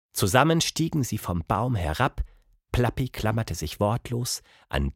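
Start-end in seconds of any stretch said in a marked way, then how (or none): none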